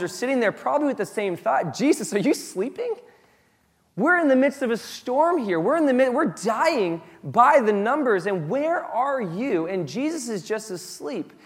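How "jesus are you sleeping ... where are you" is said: high, incredulous cry for help; acted desperate plea. Believers to sleeping Jesus amid danger, acted desperate plea. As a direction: plead as if abandoned, voice pitched up.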